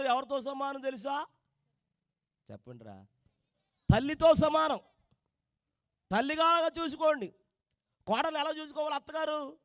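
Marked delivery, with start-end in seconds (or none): 3.9-9.6 loud, raised preacher's call; full-force preaching. Acted. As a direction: drive the sermon home to the congregation. (గట్టిగా ప్రసంగం)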